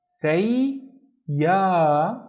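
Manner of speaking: SAY, pause, long YA; syllable by syllable. Shown as a demonstration. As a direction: sound out "say" and "ya" as separate beats. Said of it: "once with this syllable".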